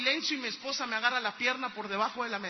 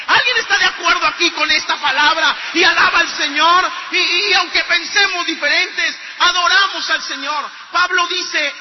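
preaching at full force; 2 semitones higher, 9 dB louder than usual. Acted. Preacher rousing the congregation about the word, full-force shouted preaching.

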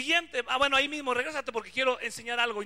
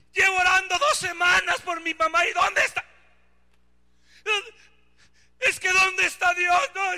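loud, higher, frustrated complaint; young man yelling at God, loud and upset; angry youth; 6 semitones higher, 6 dB louder than usual. Retold or imitated. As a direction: shout out your anger at God. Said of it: "cuántos jóvenes has oído".

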